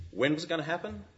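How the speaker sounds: ordinary talk, nothing marked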